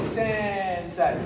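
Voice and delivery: sensei with sen drawn right out; slow first syllable. Shown as a demonstration. Teacher having everyone copy it, slow first syllable. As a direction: stretch sen, then land sei. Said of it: "people start off the mark slow".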